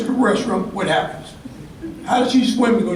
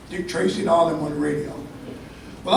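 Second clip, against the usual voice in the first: ordinary talk, nothing marked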